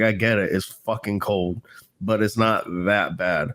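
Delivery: ordinary talk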